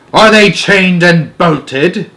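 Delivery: slow, loud, high witch demand; booming witch voice. A narrator's reading. Grand High Witch demanding of her witches, slow and booming. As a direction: drag out the question like a menacing hag. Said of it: "in a voice that filled the room".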